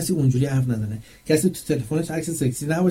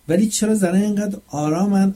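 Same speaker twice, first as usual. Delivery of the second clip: ordinary talk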